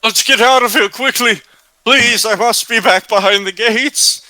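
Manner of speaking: high, hurried pleading voice; anxious character voice. Acted. Character begging the party to get back behind the gates, anxious plea.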